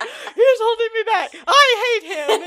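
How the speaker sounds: high, loud, slowed whiny sob-voice; mock whiny character. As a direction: ham up a petulant teen hero. Mocking whiny Star Wars character.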